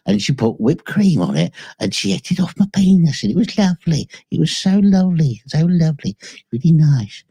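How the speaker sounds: high-pitched dopey mock voice of a friend; gushing high mock voice of the enforcer; squeaky Enforcer Matt. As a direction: pitch it way up like a giddy goofball. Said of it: "and he said".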